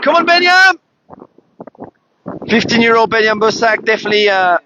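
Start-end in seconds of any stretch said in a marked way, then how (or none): 0.0-0.7 high cheer for the racer; cheering shout. Real, not acted. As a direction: urge him on across the water.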